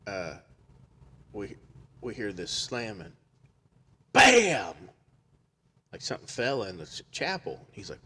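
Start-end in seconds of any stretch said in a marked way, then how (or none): 4.1-4.7 sudden high, loud "bam"; loud bang. Retold or imitated. Storyteller imitates the slamming from the chapel, explosively. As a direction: imitate a slam with a shouted word.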